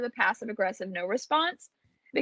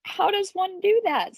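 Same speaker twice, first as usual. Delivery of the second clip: wondering aloud; 9 semitones higher than usual. Retold or imitated. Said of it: "I was like".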